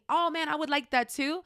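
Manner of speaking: cheerful, wishful enthusiasm; genuine excitement. Acted. Friend admiring another's purchase, excited. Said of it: "Just show genuine excitement".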